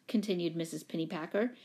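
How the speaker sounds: usual speaking voice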